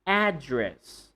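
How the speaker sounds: ADdress, strong AD with a full a; stress on first syllable. Shown as a demonstration. Teacher models the noun, first-syllable stress. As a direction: Address falling away from AD. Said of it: "if you're talking about the noun".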